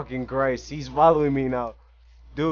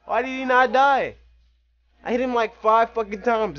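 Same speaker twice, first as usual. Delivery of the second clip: frustrated; 8 semitones higher, 4 dB louder than usual. Real, not acted. Frustrated at enemy.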